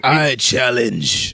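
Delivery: slow, low villain voice. Retold or imitated. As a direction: draw it out like a movie villain. Shredder voiced, slow dramatic.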